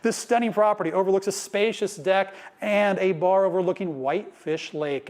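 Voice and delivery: ordinary talk, nothing marked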